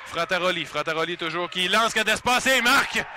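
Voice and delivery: loud, high goal call; excited sports commentary. Acted. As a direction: call the goal with rising energy. (commentaire sportif excité)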